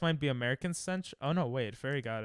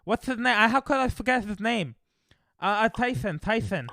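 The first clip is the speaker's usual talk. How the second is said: higher, lisping Tyson voice, a light take; Mike Tyson impression; 6 semitones higher, 5 dB louder than usual. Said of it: "the guy that talks like this".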